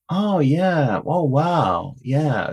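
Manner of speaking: drawn-out, mind-blown reaction, retold; amazed realisation. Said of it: "It was mind blowing to her".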